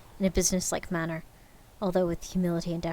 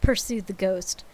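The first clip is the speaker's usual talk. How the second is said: plain reading voice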